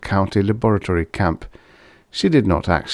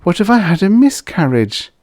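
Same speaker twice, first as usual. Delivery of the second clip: teasing; 11 semitones higher, 5 dB louder than usual. A narrator's reading. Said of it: "Doris teased".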